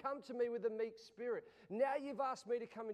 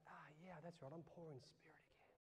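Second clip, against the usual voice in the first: lower, much softer, near whisper; whispered prayer; 7 semitones lower, 15 dB quieter than usual. Retold or imitated. Pastor confessing brokenness to the Lord in a whisper. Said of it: "And I go".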